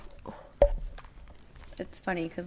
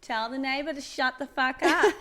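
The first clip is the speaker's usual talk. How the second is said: mob wife voice; 6 semitones higher, 5 dB louder than usual. Pretend. Mob wife barking orders.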